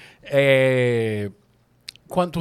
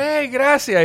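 shouted thanks; 14 semitones higher, 7 dB louder, much slower than usual. Retold or imitated. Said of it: "Y yo".

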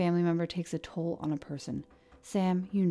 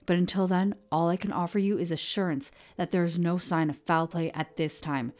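loud, stern fatherly order; angry command; 2 semitones higher, 7 dB louder than usual. Acted. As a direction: slam down the final word. Sheriff slams desk, lays down law.

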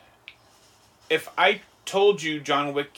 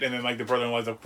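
ordinary delivery

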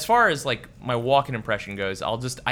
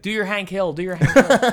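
pushy friend; 10 semitones higher, 8 dB louder than usual. Retold or imitated. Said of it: "He'll call me up and be like".

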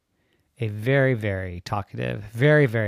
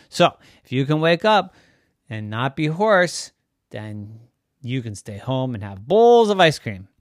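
high, loud mock-enthusiastic dad; teasing daddy; 4 semitones higher, 10 dB louder than usual. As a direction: oversell the idea playfully. Daddy voiced.

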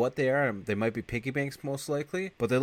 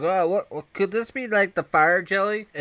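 higher, puzzled put-on voice; mock dumb cop; 10 semitones higher, 6 dB louder than usual. Bill the cop guessing at the remains, high and puzzled. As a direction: fumble for the word like a dim cop.

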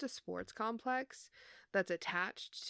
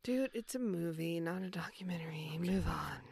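unvoiced teasing whisper; whispered nudge to move on. Real, not acted. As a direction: breathe it, teasing.

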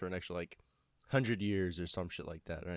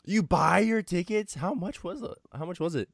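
high, loud disbelieving question; shocked, pitch jumps up; surprised; 9 semitones higher, 5 dB louder than usual. Real, not acted. Shocked question at ticket price.

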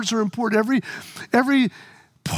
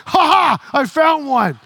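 gleeful triumph; 3 semitones higher, 4 dB louder, slower than usual. Retold or imitated.

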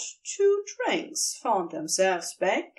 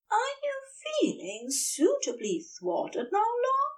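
higher, softer playful tease; lightly mock the grumpy husband; teasing Aziraphale; 11 semitones higher, 3 dB quieter than usual. A narrator's reading. Aziraphale teases Crowley after thwarting him, higher and playful.

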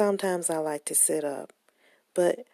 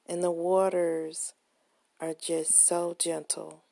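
guided meditation; 3 dB quieter than usual. Acted. Guided visualization, soft meditation voice.

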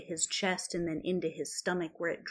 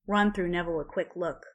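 plain reading voice

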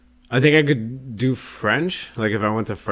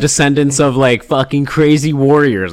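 louder, higher hype announcement; hyped shout-out; 4 semitones higher, 8 dB louder than usual. Real, not acted. Host hyping German listeners about warrior ancestors, loud and excited. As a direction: pump up the crowd like a hype man.

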